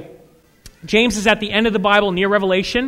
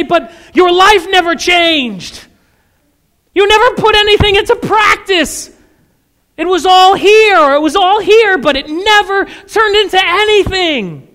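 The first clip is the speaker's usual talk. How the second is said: stern rebuke; 8 semitones higher, 7 dB louder than usual. Acted. God rebuking the churchgoer, loud and high with stern reproach. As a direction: call them out for their empty life.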